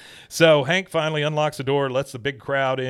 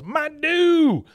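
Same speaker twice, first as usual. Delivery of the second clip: much higher, louder squeal of dismay; anguished cry; 7 dB louder than usual. Retold or imitated. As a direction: wail it like your treasure is ruined. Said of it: "he goes".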